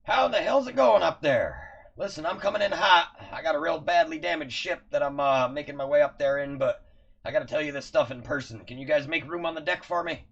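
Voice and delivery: loud, high gruff soldier bark; gruff orc commander. Acted. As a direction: shout it like an orc marine over a radio. NPC Ashnak calling in on a radio.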